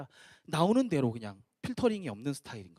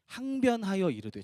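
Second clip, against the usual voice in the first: ordinary delivery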